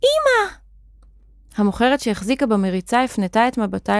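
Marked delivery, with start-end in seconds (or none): 0.0-0.5 put-on very high-pitched child's cry; startled little girl's squeal; alarmed high voice. A narrator's reading. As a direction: call out in alarm, right up high. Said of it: "בבהלה וקראה בקול גבוה".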